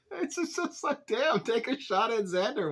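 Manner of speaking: high voice, cracking up; laughing while talking. Real, not acted. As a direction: squeak it out through laughter. Cracking up at the retold jab.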